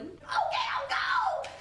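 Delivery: very high, loud shouted squeal; squealing yes. Retold or imitated. Glinda accepts the Emerald City trip, squealing. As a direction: burst with delight. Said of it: "Glinda's like".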